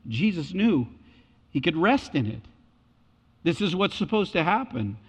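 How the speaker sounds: normal talking voice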